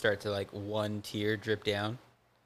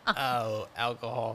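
ordinary talk

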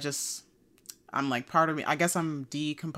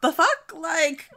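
outraged; 12 semitones higher, 4 dB louder, much slower than usual. Real, not acted.

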